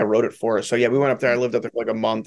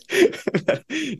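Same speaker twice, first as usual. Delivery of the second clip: real laugh breaking into the word, pitch jumping much higher. Real, not acted. Laughing mid-sentence at own idea.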